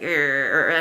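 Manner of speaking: low disgusted grunt; a quick annoyed urgh; mock angry grunt. Shown as a demonstration. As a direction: growl it like a cross parent. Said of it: "our mouths are like".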